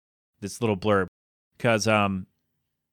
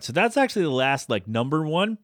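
usual speaking voice

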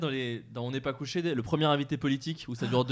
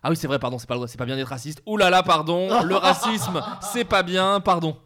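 odd over-the-top tone; 4 semitones higher, 8 dB louder than usual. Retold or imitated. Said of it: "je comprends pas le ton de la phrase".

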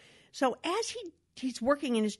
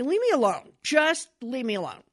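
imitating a curt, louder snap; a hint of the grumpy husband pushing her off; mock irritated husband; 5 semitones higher, 6 dB louder than usual. Husband brushing off his wife, mildly louder and irritated.

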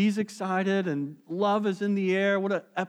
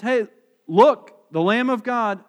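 loud, offhand pointing out; tossed off like spotting something on the road; casual hey look; 4 semitones higher, 9 dB louder, slower than usual. Shown as a demonstration. Preacher mocks a careless glance at the lamb, casual and loud. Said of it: "It's not just".

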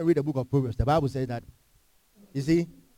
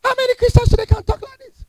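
How many Christians pitched high, faster; high emphatic repeat; faster than usual. Real, not acted. Preacher repeating the question to the congregation, high and quick. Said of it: "said again".

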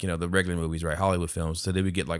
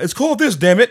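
exasperated; 12 semitones higher, 7 dB louder than usual. Retold or imitated. Imitating exasperated future commenter.

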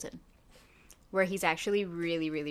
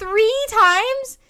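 much higher and louder, mock-scandalized; outraged disbelief; 17 dB louder than usual. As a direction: shriek it again, even more scandalized. Repeats outraged count.

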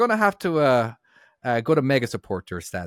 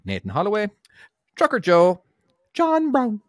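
Mickey Mouse voice; 14 semitones higher, 6 dB louder than usual. Acted. Reads names in Mickey voice.

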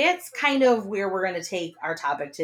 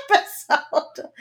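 ordinary delivery

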